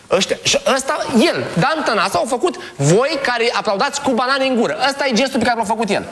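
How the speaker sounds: high-pitched, rapid indignation; exasperated rant. Real, not acted. Host fuming over an MP's gesture in Parliament, exasperated. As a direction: rant in disbelief, pitched well up.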